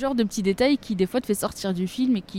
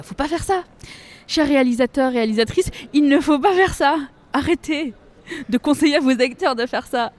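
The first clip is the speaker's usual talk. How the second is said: mock appeal to directors; 5 semitones higher than usual. Calls out to film directors.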